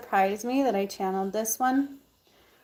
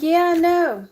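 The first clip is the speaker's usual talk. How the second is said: sassy refusal; 8 semitones higher, 8 dB louder than usual. Retold or imitated. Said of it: "I'm like".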